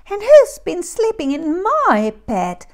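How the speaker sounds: put-on high, loud baby bear squeak; squeaky little bear. A narrator's reading. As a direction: squeal it like an indignant cub. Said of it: "squeaked the very small bear".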